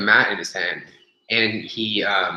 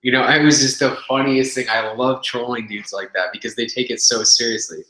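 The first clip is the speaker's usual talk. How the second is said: ordinary talk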